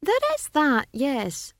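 high, magnanimous wizard voice; lofty, indulgent agreement; generous concession. A narrator's reading. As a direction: concede it graciously, patronizing. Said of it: "the wizard conceded generously".

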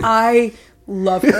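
hammed-up actor praising a co-star, drawn out; gushing Jake Gyllenhaal. Actor asked about any actress, exaggerated gushing. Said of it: "he's probably like".

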